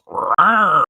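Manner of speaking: loud, high imitation big-cat snarl; imitated mountain lion roar. Storyteller imitating the animal he took for a dog, loud high roar. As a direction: let out a mock mountain lion cry. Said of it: "he makes this fucking mountain lion noise".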